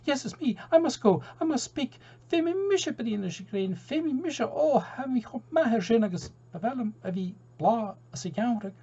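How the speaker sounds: very high, eager seagull voice, breaking into Gaelic; high eager seagull gabbling Gaelic phrases; excited seagull. Acted. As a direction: squawk it out like an over-keen gull. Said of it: "dance around with enthusiasm".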